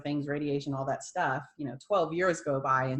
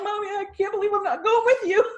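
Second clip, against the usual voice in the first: tearful laugh, much higher and louder; real tears breaking into laughter; half sobbing, half laughing; crying and laughing; 9 dB louder than usual. Real, not acted. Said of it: "And I'm crying".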